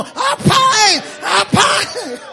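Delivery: high, loud scolding cry; a loud high mock of a mother's scold; scolding shout. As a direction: yell at the child like a fed-up mother.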